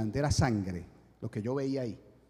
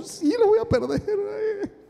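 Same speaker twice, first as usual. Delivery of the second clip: high, slow, hammed-up whine; mock wailing; much slower than usual. Man giving in to the enemy's taunt, mock wailing. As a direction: stretch it into a pretend sobbing wail.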